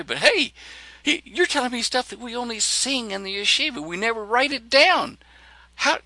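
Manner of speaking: excited rabbi. Retold or imitated.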